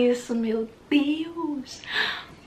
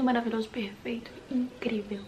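unvoiced whisper, softer; ASMR whisper; 2 semitones lower, 5 dB quieter than usual. Shown as a demonstration. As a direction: whisper the praise ASMR-style. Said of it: "eu tô viciada em A S M R".

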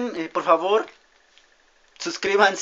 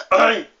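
quejido; 6 semitones higher, 8 dB louder than usual. Real, not acted. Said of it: "Mi garganta".

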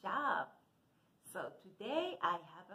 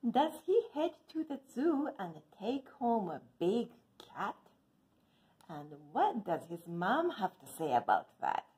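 louder, lively storybook reading; reading to children; 8 dB louder than usual. A narrator's reading. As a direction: read the book to the kids with expression. Reads picture book aloud.

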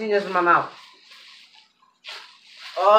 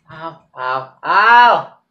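short pained cries, last one loud; pained ow, rising to a yelp; 3 semitones lower, 7 dB louder, much slower than usual. Real, not acted. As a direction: wince out the pain. Eating very spicy pepper.

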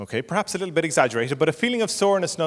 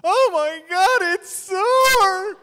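very high, loud howl of pain; hammed-up bawling, long and high; child wailing; 6 dB louder, much slower than usual. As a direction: howl like a small boy letting loose. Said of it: "And he will wail".

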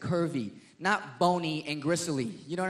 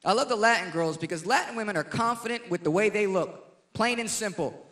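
usual speaking voice

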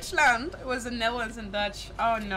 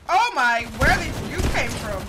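loud surprised outburst; startled; 2 semitones higher, 11 dB louder than usual. Real, not acted. Streamer startled by an enemy appearing, loud and surprised. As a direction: jump at a sudden enemy.

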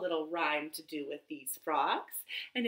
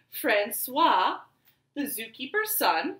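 ordinary talk

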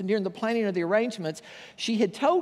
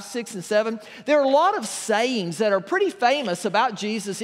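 higher and louder, preaching to the congregation; 4 semitones higher, 6 dB louder than usual. Acted. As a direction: lift into the sermon with full voice. Shifts from joke into sermon.

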